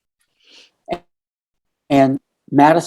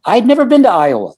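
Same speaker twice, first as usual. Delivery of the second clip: much higher, quick burst of insistence; emphatic disbelief; 9 semitones higher, 3 dB louder, faster than usual. Real, not acted. Teller insisting the message came from a stranger, emphatic. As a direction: insist it in a high, rushed exclamation.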